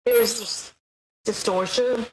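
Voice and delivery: distorted filtered voice; distortion effect. Shown as a demonstration.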